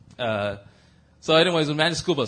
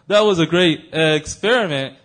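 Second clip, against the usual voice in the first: loud, slow jokester impression; Carlos; 8 dB louder, slower than usual. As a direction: play a cartoon kid setting up a gag. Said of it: "Carlos will be like".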